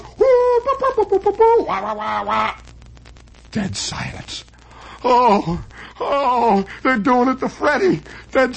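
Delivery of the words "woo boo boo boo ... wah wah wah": very high frantic woo-boo babble; frantic gibberish bit. Retold or imitated. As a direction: squeal the nonsense act. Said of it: "and he goes".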